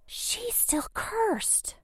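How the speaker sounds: very slow frightened whisper. Acted. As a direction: whisper it in dread. Frightened realization.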